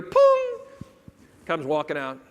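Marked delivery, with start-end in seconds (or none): 0.0-0.5 high, loud, sustained "pong"; one ringing high note; imitated call-button chime. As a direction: sing out the ding of a plane's call button. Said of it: "We hear".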